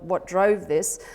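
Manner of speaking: ordinary delivery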